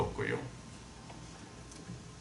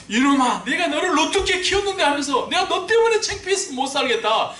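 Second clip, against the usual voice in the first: higher and louder, berating a runaway son; angry father scolding; 7 dB louder than usual. Retold or imitated. Preacher imitating a father scolding his son, loud. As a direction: yell at the kid like the old angry dad. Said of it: "이렇게 하는 아버지였었는데".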